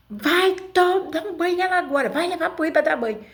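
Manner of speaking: ordens muito agudas e mais altas, rápidas no meio; mãe mandando aos gritos. Retold or imitated. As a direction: imita a mãe dando ordem apressada. (mãe mandando dar banho na irmã, aos gritos)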